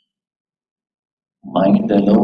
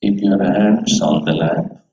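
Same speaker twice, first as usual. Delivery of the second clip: guided meditation. Acted. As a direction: guide them gently into stillness. Leads a meditation.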